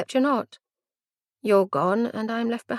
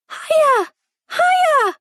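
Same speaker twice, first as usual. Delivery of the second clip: put-on high, loud squeal of a small child on a swing; excited little girl; 6 dB louder, slower than usual. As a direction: shout it like a thrilled toddler.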